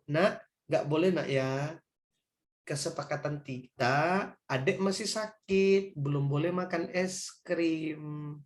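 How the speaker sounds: slow, soft parent gently saying no; calm the child down slowly; gentle refusal. Acted.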